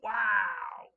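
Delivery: loud, high amazed wow; really blown away, call it out; amazed shout. Real, not acted. Amazed at a friend's Lego collection.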